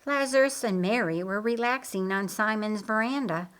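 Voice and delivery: plain reading voice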